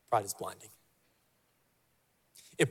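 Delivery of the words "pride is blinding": soft echo. Shown as a demonstration. Softer echo.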